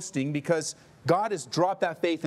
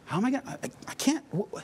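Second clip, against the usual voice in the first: stammering at a loss; 10 semitones higher, much faster than usual. Retold or imitated. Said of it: "he's at a loss for words".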